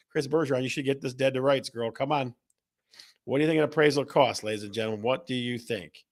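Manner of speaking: normal talking voice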